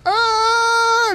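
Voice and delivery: loud, high-pitched screech of car brakes; high squealing skid noise; imitated brake screech. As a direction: screech like tires braking hard. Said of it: "screeching his brakes".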